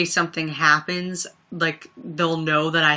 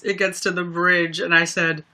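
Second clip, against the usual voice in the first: ordinary talk, nothing marked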